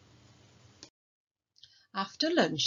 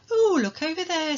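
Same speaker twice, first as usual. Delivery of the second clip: much higher, louder delighted call; delighted pointing; 10 semitones higher, 6 dB louder than usual. Acted. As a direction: point out the peacock with glee. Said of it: "said Toby's mummy".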